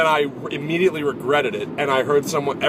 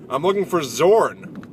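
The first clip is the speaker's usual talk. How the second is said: slow, higher proclamation; Norse traveler; 4 semitones higher, 4 dB louder, slower than usual. Retold or imitated. Norse traveler announcing his search to the village, slow mock proclamation. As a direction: call it out slowly like a sketch Norseman. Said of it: "playing a, like, a Norse traveler".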